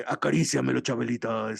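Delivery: lightly imitated seducer, sinking low; a hint of a sketch-comedy tempter; tempting man's voice. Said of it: "the voice that she would make for the men tempting her".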